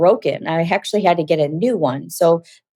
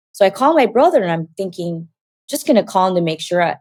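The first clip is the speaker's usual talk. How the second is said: ordinary delivery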